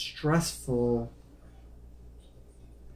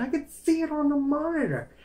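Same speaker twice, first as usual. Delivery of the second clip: high, elated explanation; excited insistence; 14 semitones higher, 4 dB louder than usual. Retold or imitated. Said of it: "I start flipping out".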